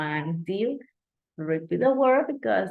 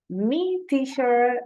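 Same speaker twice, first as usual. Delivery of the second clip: eager pupil calling out, pitched higher; eager student; 6 semitones higher, 4 dB louder, slower than usual. Retold or imitated. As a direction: call out keen to answer. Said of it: "students raising hands".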